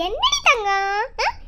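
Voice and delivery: high, loud lilting coo; playful baby talk. Acted. Talking to newborn seeing everyone new, playful baby talk. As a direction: chat indulgently to a baby.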